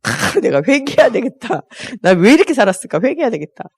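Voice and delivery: gasp, then overdo the regret; mock-repentant husband. Husband reacting to his wife's nagging, mock repentant.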